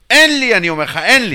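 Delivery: loud, insistent shout of having nothing; retold shout. To the treasurer Simchi, insisting he has nothing: loud shout. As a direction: belt it out, pleading and fed up.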